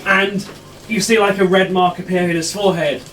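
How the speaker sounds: ordinary talk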